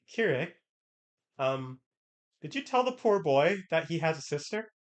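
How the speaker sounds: playful accusing voice; mock accusing Airi. Acted quote of Airi.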